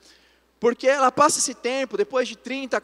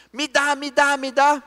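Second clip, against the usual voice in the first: higher, louder imitation of an insistent child; demanding child; 6 semitones higher, 6 dB louder than usual. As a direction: mimic a little girl demanding, raised pitch and volume. Insistent daughter demanding a toy.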